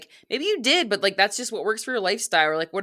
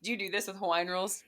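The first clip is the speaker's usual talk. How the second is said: ordinary delivery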